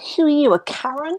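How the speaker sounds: mock indignant, throw it back at her; snarky retort. Speaker answering a nosy neighbour, high and snarky.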